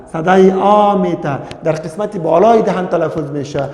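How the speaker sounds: ordinary delivery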